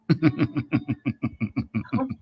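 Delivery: soft creepy evil chuckle; evil laugh. Real, not acted. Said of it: "weird, evil laugh".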